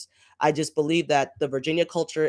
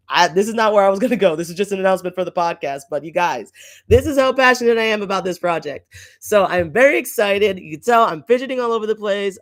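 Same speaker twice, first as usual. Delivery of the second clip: louder, fast and gushing; excited; 3 semitones higher, 6 dB louder than usual. Real, not acted. Host admits she is passionate about the podcast, excited. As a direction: spill it out, too excited to hold back. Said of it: "My voice is going all tor-, kinds of volumes".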